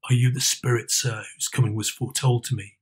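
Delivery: a lightly voiced old miser, asking warily; old Scrooge.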